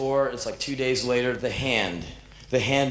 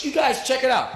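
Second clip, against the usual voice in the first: excited call; 11 semitones higher, 4 dB louder than usual. Retold or imitated. Showing bandmates his open knuckle.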